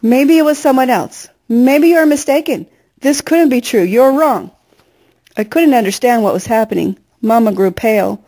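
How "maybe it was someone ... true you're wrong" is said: higher, lightly voiced pleading mother; Mama's frantic denial. A narrator's reading. Mother refuses the news on the phone, mild frantic pleading. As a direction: deny it, lightly voiced in desperation. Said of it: "Mama continued to babble".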